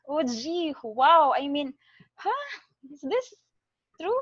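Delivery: a sudden jump up on huh, caught off guard; stunned disbelief. Acted. Beggar hearing the rich man's offer, stunned and disbelieving. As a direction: gasp it out like you can't believe your luck.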